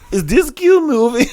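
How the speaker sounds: high, loud, drawn-out mock foreign voice; Miss Doubtfire. Imitating a foreign runner in the security queue, botched high-pitched accent. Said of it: "She didn't speak like Miss Doubtfire".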